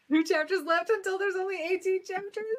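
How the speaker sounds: loud, high-pitched incredulous question; startled disbelief. Real, not acted. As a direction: blurt it out in high, loud astonishment. Startled by the chapter count.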